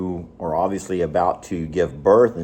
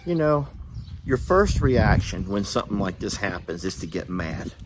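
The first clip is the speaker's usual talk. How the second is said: ordinary talk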